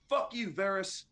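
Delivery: annoyed guard. Acted.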